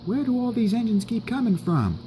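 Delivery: faint, high, small voice; barely audible. Acted. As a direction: ask in a tiny high voice nobody can hear. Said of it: "I can barely hear you".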